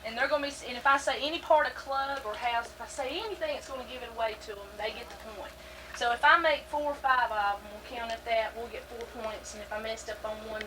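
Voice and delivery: ordinary talk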